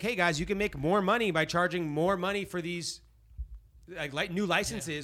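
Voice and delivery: loud salesy pitch; casino pitch voice. Pretend. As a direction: sell it to the state loudly. Voicing casinos pitching the state.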